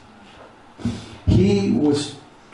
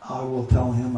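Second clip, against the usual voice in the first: ordinary talk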